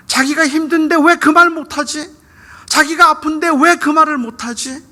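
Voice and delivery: loud, high-pitched frustrated questioning; heated, straining rhetorical questions; exasperated outcry. Acted. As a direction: cry out in disbelief at his silence. Preacher vents frustration over son's silence.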